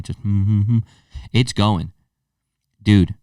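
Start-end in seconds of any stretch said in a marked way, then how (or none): 0.0-0.8 rhythmic chest hum like the Wolf of Wall Street scene; McConaughey hum. Retold or imitated. Imitating his racing heart as a McConaughey hum. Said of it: "Full Matthew McConaughey".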